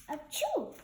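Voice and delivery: small achoo at normal level. Acted. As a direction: hold the sneeze back, keep it small. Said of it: "sneeze more soft, softer".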